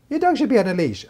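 high, prim rebuke; sniffy stranger telling you off; passerby scolding the dog owner; mock scolding passerby.

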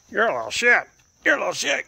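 high, loud squawk at the pet; loud teasing scold. Real, not acted. Owner calling the other pet a little shit, high loud teasing. Loud insult after whispered praise.

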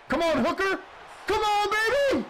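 very high, loud yell that cracks; voice-cracking shout. Real, not acted. Gamer cheering Hooker's interception, voice-cracking shout. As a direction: scream it till your voice breaks. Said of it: "Oh, golly, the voice crack".

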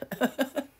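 short high giggle at her own joke; a quick high laugh. Real, not acted. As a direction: giggle lightly at the pun.

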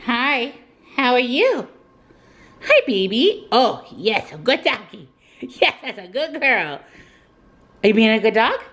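sweet pet talk; talking to a dog. Real, not acted. Greeting her dog.

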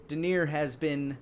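usual speaking voice

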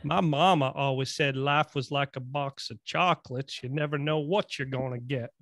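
put-on Forrest Gump's Southern accent; imitated Forrest Gump accent, plainly recited. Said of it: "That was a good accent".

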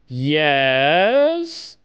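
ordinary delivery

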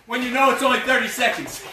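normal talking voice

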